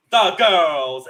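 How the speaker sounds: loud, punched-out Kent accent. Shown as a demonstration. Showing off the one phrase she can do, Kent accent. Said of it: "only one thing in a Kent accent".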